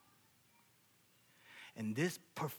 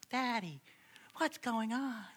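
put-on high, childlike question; kids' voice; 9 semitones higher, slower than usual. Kids come out to ask daddy, high child voice. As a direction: pipe up like a curious child. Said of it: "And my kids come out".

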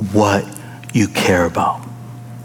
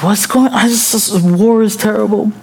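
lightly voiced, high-pitched child's confusion; bewildered little kid; 9 semitones higher than usual. Son hearing dad's war story, mock bewildered kid voice. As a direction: imitate an overwhelmed kid, pitched way up. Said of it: "so inappropriate for a little kid to hear".

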